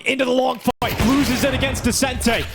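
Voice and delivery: rising excited casting; hyped play-by-play. Acted. Live play-by-play climax.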